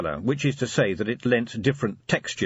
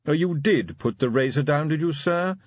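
higher, polite checking question; cautious butler; 7 semitones higher than usual. A narrator's reading. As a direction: check delicately with the master.

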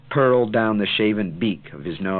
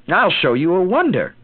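high, loud showman's call; crying out; 10 semitones higher, 6 dB louder than usual. A narrator's reading. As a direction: announce it like a magician. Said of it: "he cried at them".

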